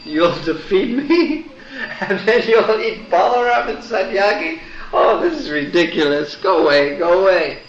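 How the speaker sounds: high, amused laughing dismissal; mocking giggle at a demon's boast; laughing scorn. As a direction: laugh him off as a joke. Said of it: "just began to laugh".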